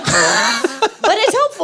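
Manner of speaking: laughing slowly while speaking; laughing, stretch it out in defence; laughing, drawn out. Real, not acted. Laughing defence after tease.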